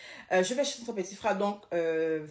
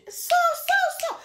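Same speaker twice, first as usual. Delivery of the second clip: very high, louder urgent cry; panicked order to get out; 6 dB louder, much slower than usual. Retold or imitated. Mother screaming at her daughter to leave the room. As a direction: yell at her to come out now. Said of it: "Ma mère crie".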